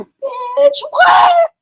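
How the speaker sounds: high-pitched shocked shriek, rising to a shout; shocked reaction. Retold or imitated. To her girlfriend at hearing gossip, a shrieking shocked squeal. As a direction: squeal it out like juicy gossip just dropped.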